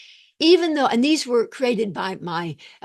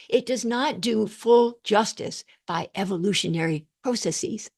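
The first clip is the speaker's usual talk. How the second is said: ordinary talk, nothing marked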